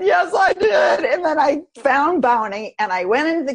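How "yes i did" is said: loud, high, drawn-out cry of "Yes, I did"; exasperated shout. Real, not acted. Mother shouting back to her daughter about forgotten popsicles, loud and exasperated. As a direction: burst out as the overwhelmed mom.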